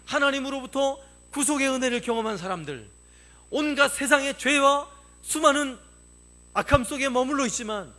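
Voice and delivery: loud, high preaching voice; climbing sermon cry; fiery preaching. Acted. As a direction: preach it out with full fervour. Preacher at full force.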